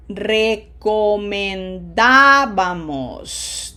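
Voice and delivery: Recomendábamos, very slow, swelling on a loud DÁ; stress on third-last syllable. Shown as a demonstration. Teacher checking students hear emphasis, slow Recomendábamos stressed on DÁ. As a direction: stretch Recomendábamos and punch the DÁ. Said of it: "Did you hear the pronunciation emphasis there?".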